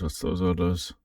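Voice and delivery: ordinary talk, nothing marked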